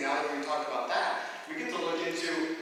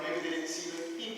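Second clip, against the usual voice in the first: ordinary delivery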